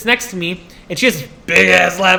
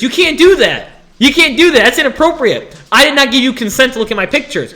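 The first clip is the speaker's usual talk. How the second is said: raised, louder indignant protest; mock outrage; 5 semitones higher, 4 dB louder than usual. Student refusing the file checkers, mock outrage. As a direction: object loudly with hammed-up outrage.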